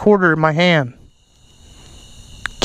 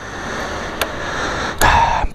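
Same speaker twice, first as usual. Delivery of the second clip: soft breathy sigh of relief; whispered sigh; 6 dB quieter than usual. Real, not acted. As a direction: let out a hushed sigh. Startled by bike noise, alone.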